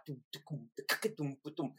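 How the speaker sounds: low, soft vocal drum beat; imitated drum groove. Musician recalling the tunes they had down, soft drum imitation. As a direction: thump out a rhythm quietly under your breath.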